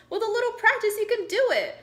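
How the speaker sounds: high, loud and eager encouragement; high, eager coaxing; bright encouragement. Acted. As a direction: cheer the little owl on, way up high.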